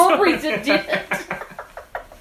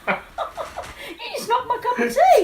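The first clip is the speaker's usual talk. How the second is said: laughing; 11 semitones higher, slower than usual. Real, not acted. Tea knocked over, laughing.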